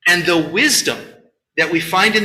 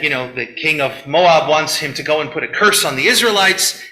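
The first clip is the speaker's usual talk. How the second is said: ordinary talk, nothing marked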